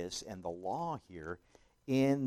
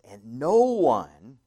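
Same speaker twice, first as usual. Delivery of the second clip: no one, slow and much louder; emphatic no one; 2 semitones higher, 15 dB louder, much slower than usual. Shown as a demonstration. Preacher stressing the Greek, loud and slow. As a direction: lean hard on no one. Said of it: "it's very emphatic in the Greek".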